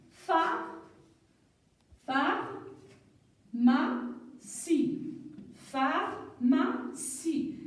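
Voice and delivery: "pharmacie" very slow, with gaps between syllables. Shown as a demonstration. Teacher counting syllables, "pharmacie" split slowly. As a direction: break "pharmacie" into separate drawn-out syllables. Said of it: "quand il y a quatre syllabes".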